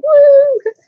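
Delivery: high, loud delighted whoop; excited whoop. Retold or imitated. Telling viewers about her half-price jumper, a high, loud whoop. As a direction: cheer at a half-price bargain. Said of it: "I was like".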